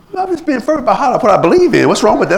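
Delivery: high, hurried self-justification; acted indignant defence. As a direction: protest in a high, pushed voice. Acts out believer defending fervour.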